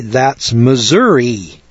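Missouri drawn out slowly, ending in "ee"; Yankee pronunciation. Shown as a demonstration. Yankee reading the spelling, Missouri ending in "ee". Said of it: "when we see M I S S O U R I".

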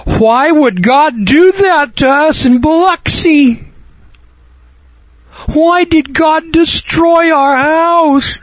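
high, slow, whiny mock plea; hammed-up high wail of the storm victims; imitating grieving victims. Preacher mocking Biloxi victims asking why, high slow whine. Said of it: "people will ask me".

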